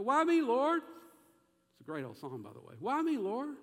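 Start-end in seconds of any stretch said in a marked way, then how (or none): 0.0-0.8 high, loud plaintive question; pleading complaint. Acted. Acting out complaint to God. 2.8-3.6 high, loud plaintive question; pleading complaint. Acted. Repeats acted complaint to God.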